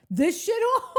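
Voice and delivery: high, laughing disbelief; incredulous. Retold or imitated. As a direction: scoff like an unimpressed tourist. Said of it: "be like".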